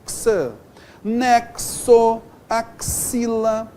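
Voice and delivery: "Nexo" and "axila" slowly split, the x a crisp ks; X as two sounds. Shown as a demonstration. Teacher showing two sounds for one letter, "Nexo, axila" slow with clear ks. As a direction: sound out "X" as "ksuh", then "Nexo", "axila" by syllables. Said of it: "Dois sons".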